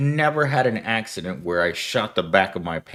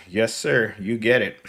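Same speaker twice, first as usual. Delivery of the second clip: put-on Western accent; 3 semitones higher than usual. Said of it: "Western accent that you could imagine".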